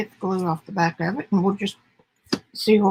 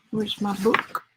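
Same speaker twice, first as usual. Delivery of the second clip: ordinary delivery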